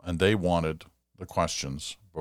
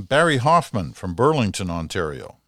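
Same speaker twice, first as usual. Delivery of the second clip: announcing a name; 2 semitones higher, 7 dB louder than usual. Real, not acted. Host announces letter writer's name.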